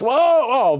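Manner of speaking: high, loud startled cry; yelped at a near miss; startled whoa. Real, not acted. Near miss at screen edge.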